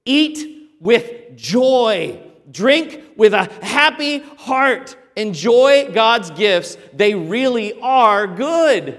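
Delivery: slow, deliberate, every word weighed; enjoy God's gifts, said slow; vivid command. Shown as a demonstration. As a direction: give it slowly as a joyful order. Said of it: "the command here is so vivid".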